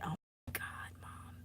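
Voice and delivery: hide in the words, barely voiced; mortified whisper. Real, not acted. Streamer to her audience, whispering in embarrassment.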